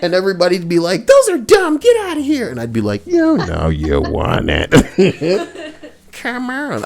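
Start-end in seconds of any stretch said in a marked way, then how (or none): 1.0-2.5 high, loud dismissive mimic; high mocking voice. Retold people dismissing him. 3.0-6.8 creepy tempting voice. Retold or imitated. Playing creepy predator.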